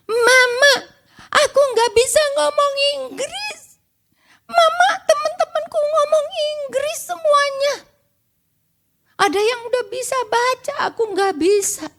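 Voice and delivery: high-pitched mock wailing to Mama; imitated crying child. Child crying to mother after first day at kindergarten, mock wailing. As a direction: imitate a little child's tearful whine. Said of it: "pulangnya dia nangis".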